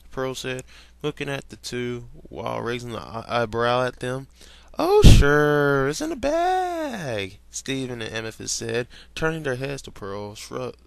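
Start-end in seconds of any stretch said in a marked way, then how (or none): 4.8-7.4 loud, high, slow boast; cocky shout. Acted. Steven and Amethyst promising Pearl, loud and cocky. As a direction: belt it out like it's easy. Kids cockily answering Pearl.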